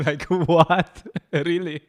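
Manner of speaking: incredulous outburst through laughter; amused disbelief. Real, not acted. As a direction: laugh while questioning it in disbelief. Mocks the Church's statement on evolution.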